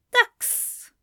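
high, forced strong call; strong safe word. A narrator's reading. Tearful angel forces out the safe word, high and strong. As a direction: push the word out as firmly as you can. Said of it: "in as strong a voice as he could manage".

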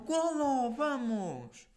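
high shout of goal; retold goal shout. Player celebrating a goal he saw go in, high shout. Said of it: "e gritei logo".

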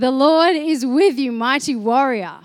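loud, ringing repeat of the verse; emphatic proclamation. Retold or imitated. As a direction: proclaim it boldly to the room.

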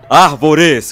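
loud blunt shout. Retold or imitated. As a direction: bark it out loud. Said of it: "chega um cara".

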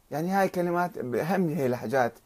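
normal talking voice

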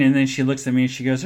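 ordinary talk, nothing marked

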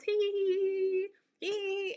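high, slow mocking hee-hee giggle. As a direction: titter it out slowly. Mock giggling gossip guy.

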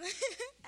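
short, high, loud giggle. Real, not acted. Speaker recalling re-met childhood friends, giggling. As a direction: let a quick high laugh escape. Laughs mid-testimony.

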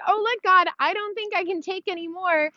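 high, overwhelmed self-quote; overwhelmed plea to God. Retold or imitated. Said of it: "how many times I was like".

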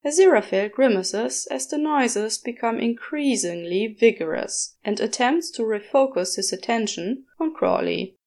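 ordinary delivery, nothing marked

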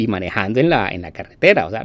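ordinary talk

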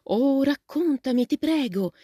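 put-on excited girl pleading for a story; eager pleading. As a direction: beg him to tell, all lit up. Joe begs to hear travels.

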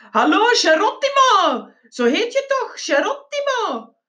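high-pitched hollered greeting; loud bellow. A narrator's reading. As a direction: holler it high, stretching the name. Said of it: "brulde luid". (hoog, joviaal gebrul)

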